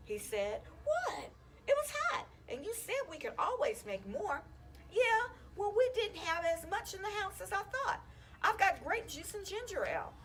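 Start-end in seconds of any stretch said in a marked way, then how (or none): 0.8-4.4 lightly voiced boy, raised pitch, defensive; a boy shrugging off blame, voice pitched up; kid's voice. Young boy Scott answers. 4.9-10.1 lightly voiced boy, higher and a bit louder, grumbling; a boy explaining, pitched up; kid's voice. Evan explains to Scott.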